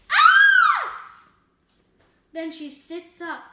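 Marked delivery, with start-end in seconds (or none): 0.1-1.1 piercing high-pitched scream; loud scream. A narrator's reading. Girl waking from a nightmare, screaming loudly. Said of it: "She screams loudly".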